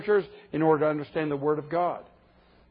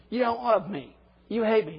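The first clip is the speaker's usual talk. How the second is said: put-on higher, sulky child's accusation; whiny kid; 5 semitones higher than usual. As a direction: sulk like a moody teenager. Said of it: "a little four-year-old".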